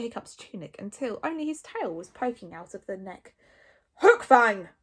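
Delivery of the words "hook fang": loud, commanding call; full-volume bark; Stoic bellowing. A narrator's reading. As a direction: bellow the name as a reprimand. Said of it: "bellowed Stoic".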